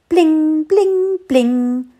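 three high, loud bling rings; repeated bling sound. A narrator's reading. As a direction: ring out three little bells. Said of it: "hörte er kurz darauf wieder".

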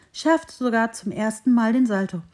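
plain reading voice

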